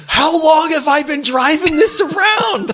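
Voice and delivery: high, loud, laughing through the question; giggling, half-shocked; laughing disbelief. Retold or imitated. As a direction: crack up at yourself mid-line. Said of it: "So then I'm like".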